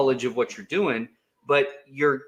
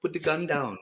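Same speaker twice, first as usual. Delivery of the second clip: calm, soothing voice; 3 semitones higher, 5 dB quieter than usual. Retold or imitated. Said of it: "your very calming voice".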